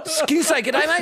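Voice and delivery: very high, eager mock voice; gushing attempt at "g'day, mate"; excited American greeter. Imitating Americans greeting an Australian, over-excited.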